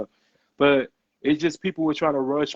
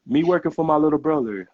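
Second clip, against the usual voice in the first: mocking quote; 4 semitones higher, 3 dB louder, faster than usual. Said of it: "You know what she told me?".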